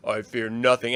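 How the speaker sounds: low, quiet, menacing Vader; deep Vader. Pretend. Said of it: "And he's like".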